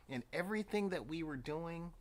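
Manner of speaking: usual speaking voice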